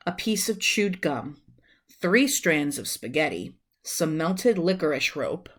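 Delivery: ordinary delivery, nothing marked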